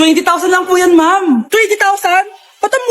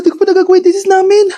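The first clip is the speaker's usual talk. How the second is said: ordinary talk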